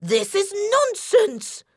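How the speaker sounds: irritated wolf-granny. A narrator's reading. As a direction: huff it out indignantly. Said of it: "continued the wolf".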